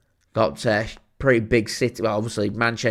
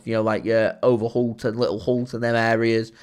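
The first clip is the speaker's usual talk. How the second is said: ordinary talk, nothing marked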